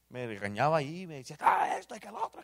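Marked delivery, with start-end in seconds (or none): 1.4-2.4 much higher, mocking the angry brother-in-law; half-joking parody of an angry rant; mock angry scolding. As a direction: imitate a scolding in a put-on high voice. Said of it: "así, pero enojado".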